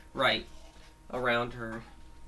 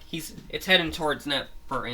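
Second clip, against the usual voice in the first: ordinary talk, nothing marked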